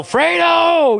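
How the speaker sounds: high, loud, drawn-out movie yell; mock anguished yell. Imitating Michael crying out for his brother, mock yell. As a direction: holler the name out long and high. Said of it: "so he could yell".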